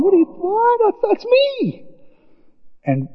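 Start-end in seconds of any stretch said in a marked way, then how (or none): 0.0-1.8 hammed-up high Jesus; puzzled Jesus. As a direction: play Jesus baffled, high. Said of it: "there's Jesus walking along".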